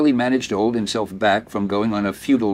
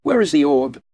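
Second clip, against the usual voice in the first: cold demand; 6 semitones higher, 4 dB louder than usual. A narrator's reading. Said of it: "her smile instantly gone".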